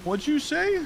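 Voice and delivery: very high-pitched, put-on incredulous question; mocking high voice of someone taken aback; mock-stunned troll. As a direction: imitate a rattled guy squeaking in disbelief. Imitating the stunned troll's reply.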